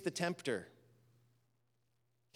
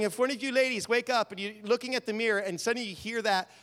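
ordinary delivery

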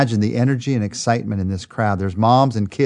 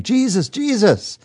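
high, slow calls of "Jesus, Jesus"; crying out a name; 9 semitones higher, slower than usual. Retold or imitated. Mothers call out to Jesus across the crowd, pitched high and slow. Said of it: "they start to cry out his name".

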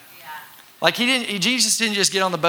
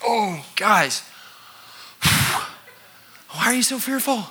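slow, sighing, weary voice, higher; weary sighing Jesus gently scolding his disciples; tired Jesus; 5 semitones higher, much slower than usual. Retold or imitated. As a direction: sigh it like an exhausted teacher. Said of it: "tired Jesus right now going".

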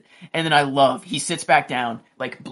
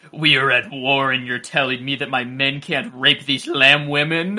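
mock outraged Drogo; 5 dB louder than usual. Drogo voiced angrily to wife.

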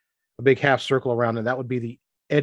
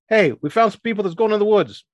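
raised, high-pitched holler; calling over; 10 semitones higher, 4 dB louder than usual. Acted. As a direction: call out to a workmate at a distance. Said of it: "he yells over toward that big golem".

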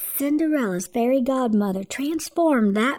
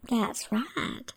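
ordinary delivery, nothing marked

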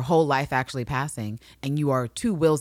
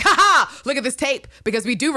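high loud mock gloat; triumphant gloating laugh; 10 semitones higher, 6 dB louder than usual. Looking Glass gloating over the tape, high mock triumph. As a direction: crow it like you've won.

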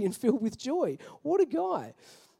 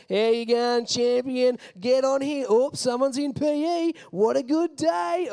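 higher, louder chirpy greeting; cheery bus driver; 4 semitones higher, 4 dB louder, slower than usual. Retold or imitated. Bus driver Paulie greeting kids, higher chirpy and slow. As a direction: greet each kid like a beaming host. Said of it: "he'd be like".

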